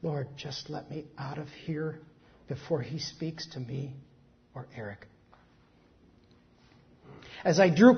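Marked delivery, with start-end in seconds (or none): 0.0-3.9 soft, low murmured prayer fading away; mother praying to God she can slip past, hushed. Retold or imitated. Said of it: "I uttered a prayer". 4.5-5.1 soft, low murmured prayer fading away; mother praying to God she can slip past, hushed. Retold or imitated. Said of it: "I uttered a prayer".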